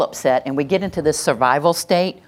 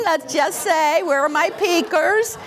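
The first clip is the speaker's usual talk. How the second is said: ordinary talk, nothing marked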